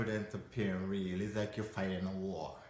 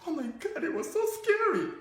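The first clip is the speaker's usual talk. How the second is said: put-on high-pitched kid squeal, building louder; thrilled child; 5 dB louder than usual. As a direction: play a child exhilarated after the scare. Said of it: "if you ask that child".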